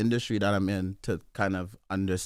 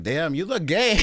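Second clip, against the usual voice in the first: high amused quote through laughter; laughing; 7 semitones higher, 4 dB louder than usual. Retold or imitated. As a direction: laugh while quoting. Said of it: "being like".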